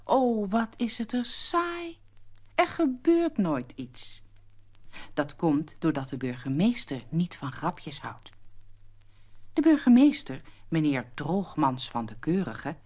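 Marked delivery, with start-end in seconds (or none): none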